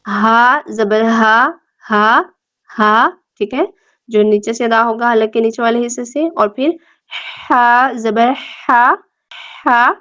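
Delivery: ہا then ہا, chest vs throat; two kinds of ha. Shown as a demonstration. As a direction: set the two ہا sounds apart. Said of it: "ان میں فرق جو ہے وہ رکھنا ہے".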